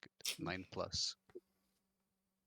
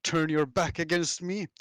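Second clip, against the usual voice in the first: loud, raised challenge in character; indignant shout; 7 semitones higher, 9 dB louder than usual. Acted. Warrior challenging the creature leaping past him, indignant shout. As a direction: shout it as an affronted fighter's challenge. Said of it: "he just shouts".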